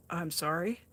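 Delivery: normal talking voice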